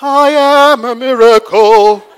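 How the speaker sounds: loud, slow, swaggering chant; hammed-up gangster chant; gangster attitude. Shown as a demonstration. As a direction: give it street attitude. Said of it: "you're just kinda like".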